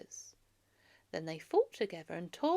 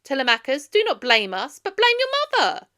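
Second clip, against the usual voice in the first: loud, much higher scornful retort; scornful suitor; 7 semitones higher, 15 dB louder than usual. Acted. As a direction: throw the blame back with scorn. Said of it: "stood up and said".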